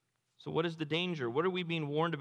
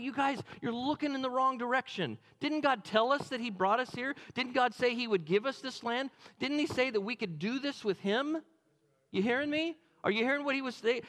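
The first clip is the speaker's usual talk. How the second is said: high, pressing hey wait; urgent plea; 8 semitones higher than usual. Acted. Caleb and Joshua urge the frightened crowd, higher and pressing. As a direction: grab their attention and push.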